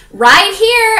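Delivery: high, loud, insistent cry; flustered call. Acted. Flustered quote as light comes on.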